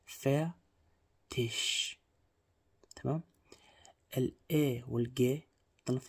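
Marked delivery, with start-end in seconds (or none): 0.1-1.9 teacher modelling Fertig slowly; light r in Fertig. Shown as a demonstration. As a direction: soften the r in Fertig and breathe the ending out as sh. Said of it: "أو الر خفيف".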